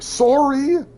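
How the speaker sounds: high, loud, rounded Canadian sorry; Canadian-accented apology. Pretend. Canadian excusing flames to the neighbours, shrieked-up mock Canadian accent. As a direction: call out a hammed-up Canadian apology. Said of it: "with the very Canadian accent".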